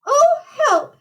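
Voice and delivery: very high, frightened pig wail; shivering. A narrator's reading. Horace hears the wolf coming after them, shivering fright. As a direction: cry for help in a shivery squeak. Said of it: "shivered Horace".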